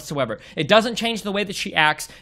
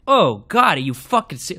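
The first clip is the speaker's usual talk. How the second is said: ordinary talk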